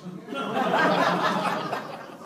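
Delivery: loud breathy laughter. Real, not acted. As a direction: laugh it off loudly. Laughs about the missed shot.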